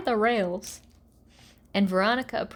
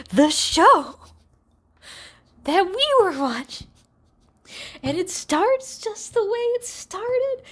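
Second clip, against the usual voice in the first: loud, higher-pitched disbelief at the twist; excited reveal; 8 semitones higher, 6 dB louder than usual. Real, not acted. Recapper shouting the show's meta twist to viewers, excited. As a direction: hit it like the punchline of the century.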